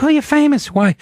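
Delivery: high mock mom voice; imitating mom. Son mimicking his mother, high and mocking. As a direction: pitch way up, playing his mother. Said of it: "My mom's like".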